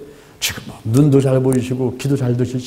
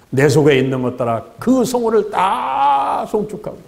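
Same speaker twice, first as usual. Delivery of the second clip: solemn scripture reading; 6 semitones higher, slower than usual. Acted. Preacher reciting Psalm 103 to the congregation, slow and solemn. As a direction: proclaim each phrase slowly like holy words.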